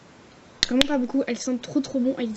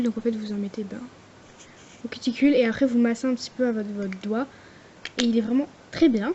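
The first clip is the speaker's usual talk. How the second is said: ordinary delivery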